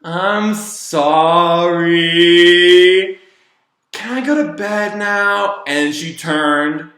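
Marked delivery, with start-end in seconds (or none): 0.0-5.6 put-on drawn-out, higher-pitched kid's sorry; sudden meek apology. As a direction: slowly sing out a child's apology, imitating her. Retold kid suddenly apologising.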